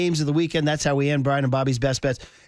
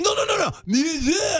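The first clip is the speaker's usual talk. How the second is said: high, hammed-up insistent correction; mock French teacher; 12 semitones higher than usual. French teacher still correcting a student, mock exasperated squeal. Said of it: "And she's like".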